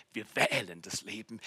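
usual speaking voice

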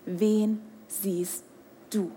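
low, soft and drawn out; soft slow question. Acted. Preacher asks the crowd softly before whispering. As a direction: ask it quietly, leaving a pause.

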